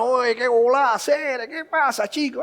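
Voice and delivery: lilting mock Cuban, vowels stretched; Cuban accent. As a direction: put on an exaggerated Cuban accent. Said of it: "habla como un cubano".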